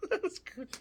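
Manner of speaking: high, squeaky laughing words; helpless laugh breaking into the words; real laughter. Real, not acted. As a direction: crack up while talking. Cracks up at savage answer.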